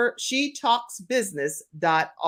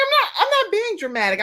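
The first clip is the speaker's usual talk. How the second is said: high, quick protest, retold; mock-defensive daughter, pitched way up; indignant protest; 13 semitones higher, faster than usual. Daughter answering mom back about being dramatic, indignant protest. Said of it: "Kim, stop being so dramatic".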